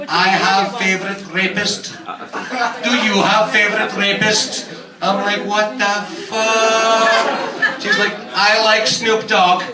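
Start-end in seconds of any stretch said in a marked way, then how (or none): 0.1-4.7 put-on Czech-accented date, voice lifting on the question. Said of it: "She's Czech. Then she's like". 5.5-7.8 long drawn-out, stunned disbelief; mock shock. Acted shock at the date's question. 8.3-9.6 put-on higher, slow Czech-accented girl. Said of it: "She's Czech. She's like".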